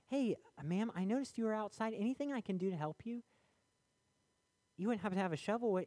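high, friendly passerby voice, lightly put on; helpful stranger. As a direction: call out kindly to the lady by the road. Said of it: "Somebody come along".

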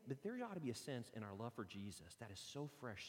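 ordinary delivery

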